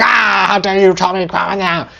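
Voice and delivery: high indignant mock yell; outraged partner's comeback, mock indignant; mock outrage. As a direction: play the offended yeller, hammed up.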